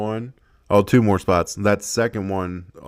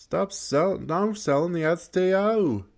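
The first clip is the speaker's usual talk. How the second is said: high, disbelieving repeat; incredulous complaint; 9 semitones higher than usual. Real, not acted. Gamer repeating his complaint at the screen, still high and amazed.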